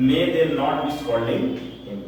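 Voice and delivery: very slow, spaced-out model question; slow dictation. Shown as a demonstration. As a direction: lay each word down for note-taking. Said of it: "ध्यान से समझो". (बहुत धीमे, साफ़-साफ़)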